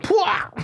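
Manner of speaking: high-pitched rapper's ad-lib yelp; sudden mimicked hype shout, very high. Launching into rapper impression for a friend, high yelp.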